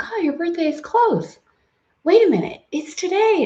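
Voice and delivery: mock realizing it's his birthday today; surprised bartender. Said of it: "And he's like".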